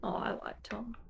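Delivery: hushed aside; whispering. Real, not acted. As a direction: whisper it like a secret. Playful confession about Tom Cruise.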